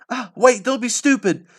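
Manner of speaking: high, loud call to stop; calling after. Acted. Tsukumojuku calling after the running boy, high loud shout. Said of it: "called after me".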